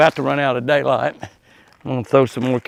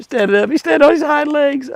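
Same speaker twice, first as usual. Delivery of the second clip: excited; 13 semitones higher than usual. Real, not acted. Buck rears up for carrots.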